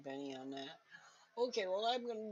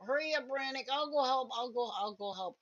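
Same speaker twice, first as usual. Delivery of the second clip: raised, loud in-character call; 8 semitones higher, 7 dB louder than usual. Acted. Player in character urging fighter on, calling out. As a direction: call ahead to hurry a comrade.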